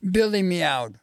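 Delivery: ordinary delivery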